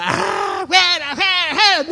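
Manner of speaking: high, loud yelling "fuera"; mock shouting. Shown as a demonstration. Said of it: "no, se, se ríe el demonio". (gritos agudos y fuertes de "fuera", como ejemplo equivocado)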